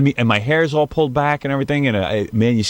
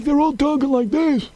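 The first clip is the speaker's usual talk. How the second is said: gritty, strained, much higher voice; gravelly voice; 13 semitones higher than usual. Shown as a demonstration. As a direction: rasp the words out as if after a nose job. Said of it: "they get this gravelly voice afterwards".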